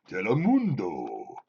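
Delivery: very low, slow Spanish announcer. Acted. Demonstrating the cooler low delivery, Spanish announcer accent. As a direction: sink deep and draw out the Spanish vowels. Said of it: "you gotta get low".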